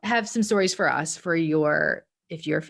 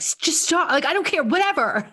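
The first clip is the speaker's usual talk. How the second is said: exasperated, higher pitched brush-off; fed up; 11 semitones higher than usual. Retold or imitated. Mom snapping at her son, fed up and higher. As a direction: throw your hands up and wave it off. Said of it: "I'm like".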